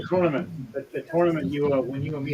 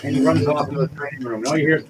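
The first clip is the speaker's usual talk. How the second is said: ordinary talk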